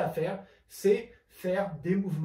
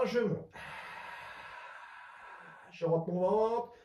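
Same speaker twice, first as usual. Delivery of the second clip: normal talking voice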